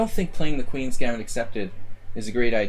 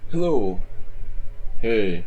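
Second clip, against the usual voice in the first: chewing panda voice; slower than usual. Pretend. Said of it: "Extra masculine Panda".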